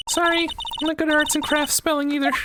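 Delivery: put-on high young girl voice, pleading then indignant; a little girl scolding a promise-breaker; girl's voice. Voicing the girl character again.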